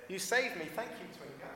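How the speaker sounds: high, soft, relieved thanks; grateful. A narrator's reading. Toto thanking Twiga for saving him, grateful. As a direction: say it softly in a small high voice.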